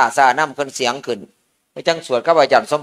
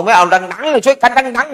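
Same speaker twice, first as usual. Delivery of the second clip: high, loud call to chant louder; urging everyone louder; 8 semitones higher, 3 dB louder than usual. Retold or imitated. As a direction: egg the monks on to chant up loud. (ตะโกนเร่งให้สวดดังๆ เสียงสูง)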